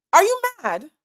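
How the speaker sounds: high, loud disbelieving question; can't believe what she's hearing; incredulous. Real, not acted. Incredulous at commenters' logic.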